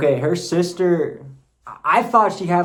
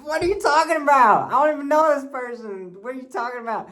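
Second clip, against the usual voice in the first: pitched-up shocked protest at a wild claim; incredulous; 11 semitones higher than usual. Retold or imitated. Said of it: "I was like".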